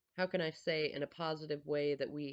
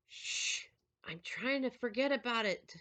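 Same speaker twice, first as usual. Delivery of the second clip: a light hint of a higher kid voice shushing; 8 semitones higher, 4 dB louder, slower than usual. Son shushing mom while he manifests, lightly voiced. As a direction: play the boy, lightly, hushing mom so he can forget.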